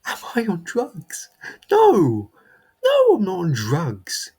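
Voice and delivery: high, loud, offended denial; indignant outburst. Acted. Accused of drugs at work, he protests in outrage. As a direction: spit out the denial, deeply insulted.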